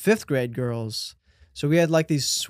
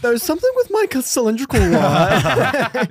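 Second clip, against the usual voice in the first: laughing mockery; 7 semitones higher, 4 dB louder than usual. Mocking the smart word.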